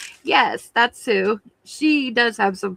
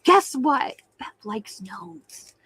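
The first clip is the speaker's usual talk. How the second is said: a hint of a gossipy tattler, slow and hushed on the news; teasing tattle-tale voice, drawn out; mock tattletale; much slower than usual. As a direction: playfully imitate a friend running to spill a secret. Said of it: "running off to tell her".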